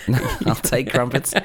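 laughing while speaking; giggly and amused. Real, not acted. Joking about crumpets.

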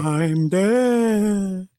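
high, very drawn-out spooky voice; wailing ghost. Pretend. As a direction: stretch it out like a cartoon ghost. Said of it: "an actual live ghost".

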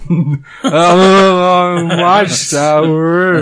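slow, drawn-out Dylan drawl; sing-speak it like Dylan; [name] impression. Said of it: "was my [name] doing [name]".

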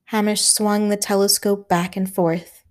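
plain reading voice

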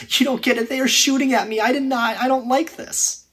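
high, loud whining complaint; mock soldier griping under fire; whiny complaining soldier. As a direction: whine like a scared soldier to his officer. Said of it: "began complaining".

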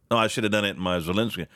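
ordinary talk, nothing marked

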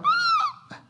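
put-on a child jumping in fright; startled kid caught reaching for the stove; child's startled yelp. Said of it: "they might be like".

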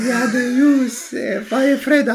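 high, insistent mock classmate voice; imitating the classmate. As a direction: push the word ljus up, near laughing. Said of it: "jag måste göra hans röst då".

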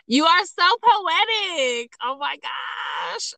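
high, drawn-out squeal of praise; gushing delight. Real, not acted. Host praises guest's fire metaphor, gushing squeal.

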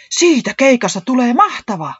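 high, loud gleeful outburst; enthusiastic. A narrator's reading. Säämies looks forward to the gig, enthusiastic. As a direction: cheer about it while bouncing with excitement. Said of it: "Säämies sen sijaan innostui".

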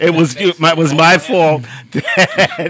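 slow laughing confession. Real, not acted. Owning up to the church delay, slow and laughing. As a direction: draw it out while laughing. Admitting fault while laughing.

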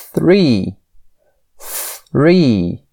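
"Three" said very slowly, breath puff then re; "Three" with an audible puff of air on TH; slow over enunciated three. Shown as a demonstration. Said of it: "Vou falar bem devagar que você vai perceber um sopro".

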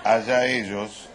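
dismissive. Acted. Dismissive aside.

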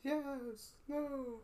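high, drawn-out crowd answer; pretend crowd. Co-host answers as the crowd about Mickey, in pretend crowd voice. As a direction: play a whole audience in a thin high voice. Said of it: "trying to pretend to be the crowd".

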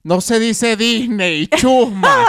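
loud, slow mock-outraged correction; scolding character. Host parodying the viral character about brands, mock scolding. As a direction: berate them comically, rising to a yell on the insult.

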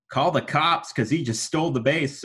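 stolen base call. Shown as a demonstration. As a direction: sell the catchphrase to the crowd. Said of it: "I'd say".

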